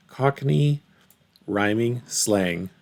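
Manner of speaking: slow, careful repeat of an unfamiliar phrase; slowly repeating. Retold or imitated. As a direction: sound out each word slowly. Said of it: "She said".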